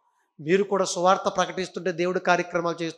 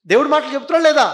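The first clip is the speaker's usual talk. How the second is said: louder repeat; 6 semitones higher, 9 dB louder, faster than usual. Acted.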